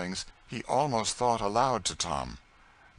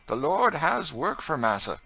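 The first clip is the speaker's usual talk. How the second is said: earnest, tearful; 9 semitones higher, 7 dB louder than usual. Acted. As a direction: say it with tears and faith. Tom continues tearfully.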